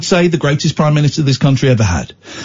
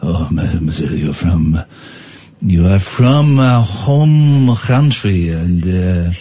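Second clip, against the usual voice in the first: suave, hammed-up French suitor accent; slow French-accented wooing; 3 semitones lower, slower than usual. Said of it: "in my French accent".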